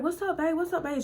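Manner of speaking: high, soft, sugary greeting; sweet greeting. Retold or imitated. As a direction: coo it up to win him over. Tiffany greets her boyfriend.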